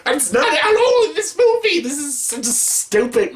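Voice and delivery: high exasperated rant, settling down. Real, not acted. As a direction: burst out very high, then come down. Ranting about the Mario movie.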